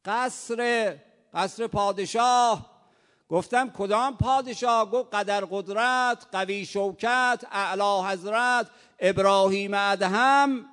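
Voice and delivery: loud, high, slow royal titles; proud guard's shout. Acted. Guard proclaiming the king to the traveller, loud and slow.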